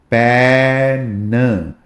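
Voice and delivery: पैन drawn out very slowly, stretching the ए; slow drawn-out pen. Shown as a demonstration. Teacher drawing out पैन so students hear the ए. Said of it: "ए की sound".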